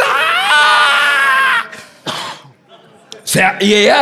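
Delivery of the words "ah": very high, loud shrieking ah; wife jolted awake by a truck, mock shriek; imitated wife's scream. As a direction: shriek like a woken, terrified wife. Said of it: "Ella dice".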